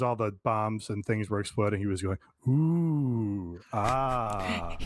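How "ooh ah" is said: lightly imitated awed ooh and ah; oohing at fireworks. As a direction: coo like watching fireworks. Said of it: "like they were fireworks".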